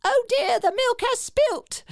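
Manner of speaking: high-pitched, hammed-up British dismay; over-the-top British accent. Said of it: "kind of an over-the-top British accent".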